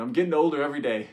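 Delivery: loud, fast joking grandpa line; lively grandpa quip. Retold or imitated. Grandpa quipping about aging, loud and fast. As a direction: rattle it off like a cheeky grandpa. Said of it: "he said, like".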